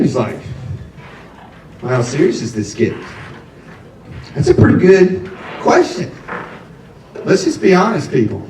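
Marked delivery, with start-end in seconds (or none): 1.8-3.0 lower, quiet fatherly probing; a hint of a gruff dad. Dad asking his son at the beach, lower and quieter.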